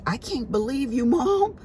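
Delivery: put-on high-pitched whiny teenage protest; exasperated son, hammed up high; mocking whiny son. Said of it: "he was like".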